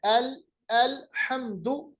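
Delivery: "अल, अल्हम्दु" slowly, each syllable separated; tajweed demonstration. Shown as a demonstration. Teacher showing how to read "अल्हम्दु", slow tajweed. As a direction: recite "अल्हम्दु" with slow, exact articulation. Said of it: "देखिए कैसे पढ़ना है".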